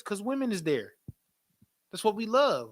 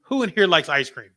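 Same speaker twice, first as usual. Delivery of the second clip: usual speaking voice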